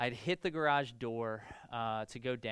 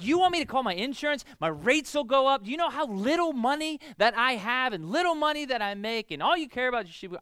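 shouted high rant, rushing; losing it; 10 semitones higher, 11 dB louder than usual. Retold or imitated. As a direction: fire it back at dad in a fury. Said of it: "lost my stuff with my dad".

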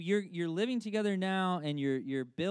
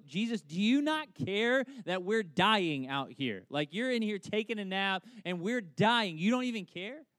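higher, louder indignant panic; freaking out; 5 semitones higher, 6 dB louder than usual. Retold or imitated. Disciples wake sleeping Jesus in the storm, mock-frantic protest. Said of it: "his disciples are freaking out".

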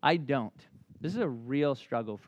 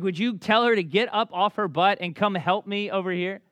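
loud, higher mock complaint; hammed-up outrage at a lazy sister; furious Martha; 8 semitones higher, 10 dB louder than usual. Pastor acting Martha complaining to Jesus, mock-furious and loud. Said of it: "Martha is furious".

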